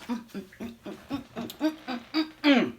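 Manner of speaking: closed-mouth mm of delight while tasting; yummy humming. Real, not acted. Tasting her dish on camera, delighted humming. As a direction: hum your pleasure at the first bite.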